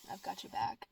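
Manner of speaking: hushed, breathy promise of support; a soft whisper of loyalty to Donald; whispered reassurance. Real, not acted. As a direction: whisper it close like a secret vow. Reassuring Trump, softly.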